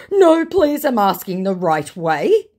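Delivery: high, loud mock begging; hammed-up insistence; pleading scammer. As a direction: beg loudly in a put-on scammer voice.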